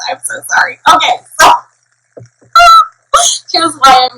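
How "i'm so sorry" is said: ordinary talk, nothing marked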